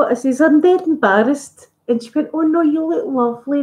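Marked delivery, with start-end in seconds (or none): 0.4-1.7 embarrassed admission. Retold or imitated. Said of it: "And I was like". 2.3-3.6 high, kind reassurance; reassuring. Retold or imitated. Fan comforting the embarrassed YouTuber, high and reassuring.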